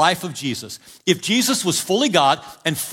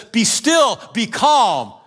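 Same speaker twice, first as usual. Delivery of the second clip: loud, high command to the storm; commanding shout; 5 semitones higher, 4 dB louder, much slower than usual. Retold or imitated. Jesus speaking to the winds and waves, a loud commanding shout. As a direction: order the wind and waves to stop.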